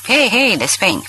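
calling out. A narrator's reading. Ab calling out inside de Spain's house, lightly voiced call. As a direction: hail the house owner from the doorway, mock shouting. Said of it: "s-a oprit acolo strigând".

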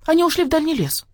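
high little pup voice; thin puppy yap. A narrator's reading. Pup reports the dogs are gone, thin yap. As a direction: pipe it up like a small dog. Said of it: "тоненько тявкнул".

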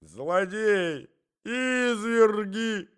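high, drawn-out cry of outrage; long accusing wail; shrieking lady. A narrator's reading. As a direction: cry out high, stretching the words. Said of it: "кричала дама".

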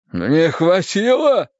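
slow, high echoed question; drawn-out astonished moan; pained disbelief. Acted. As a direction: repeat it incredulously, weakly. Poisoned man echoes in disbelief.

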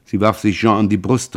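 ordinary delivery, nothing marked

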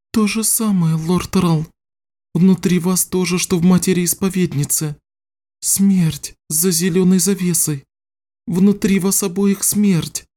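put-on high-pitched girl's voice, grave; a young girl delivering a dreadful finding; tearful young sorceress. Voices young sorceress after her tears.

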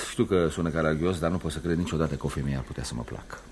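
usual speaking voice

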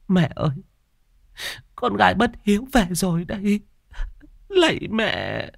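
high, wailing daughter's lament; grieving cry. Acted. Daughter weeping before her mother's altar, wailing.

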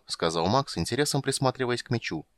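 plain reading voice

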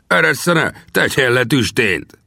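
high-pitched protesting order; indignant cry. A narrator's reading. Gunsmith dangling in the air demands release, crying out. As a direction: cry out to be let down, high and drawn out. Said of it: "kiáltotta lábával kalimpálva".

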